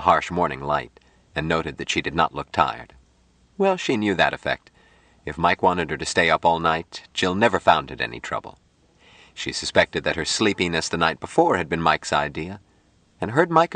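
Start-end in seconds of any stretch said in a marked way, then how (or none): none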